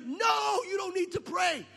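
high, loud scolding shout. Retold or imitated. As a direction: shout him down. Wife shouting at husband.

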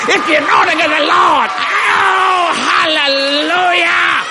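preacher's joyful hallelujah; jubilant shout. Real, not acted. Celebrating, little dance.

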